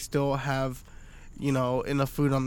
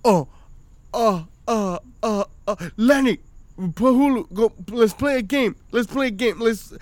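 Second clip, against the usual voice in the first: put-on high, loud, eager kid trying to distract; a hammed-up eager child changing the subject; 7 semitones higher, 5 dB louder than usual. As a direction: pitch it up like a scheming little boy. Son voiced distracting little brother.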